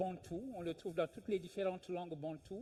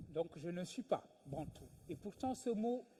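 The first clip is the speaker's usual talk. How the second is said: ordinary delivery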